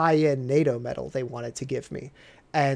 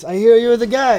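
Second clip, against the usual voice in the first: big soldier's greeting; 7 semitones higher, 9 dB louder than usual. Retold or imitated. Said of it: "And he goes".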